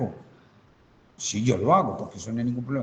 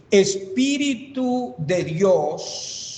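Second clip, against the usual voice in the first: very drawn-out, raised voice; slow dictation; 4 semitones higher, much slower than usual. Shown as a demonstration. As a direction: stretch each word right out as if dictating. Reading out the phrase as written.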